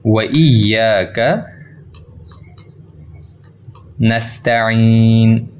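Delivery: व इय्याका नस्तईन drawn out very slowly, doubled y stressed; slow careful recitation. Shown as a demonstration. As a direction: recite each word slowly with full stress. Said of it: "इसी तरह से पढ़ना है आपने".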